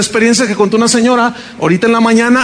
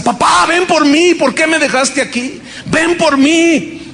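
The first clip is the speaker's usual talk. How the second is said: high, slow pleading to the dead father; pleading lament; 7 semitones higher, slower than usual. Retold or imitated. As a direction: beg him to come for her, drawn out. Grieving daughter pleading to father.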